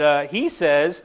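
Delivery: ordinary talk, nothing marked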